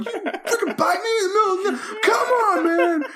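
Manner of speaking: very high, outraged squealing through laughter; freaking out. Retold or imitated. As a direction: act out a hammed-up meltdown. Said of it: "I'm, like, freaking out".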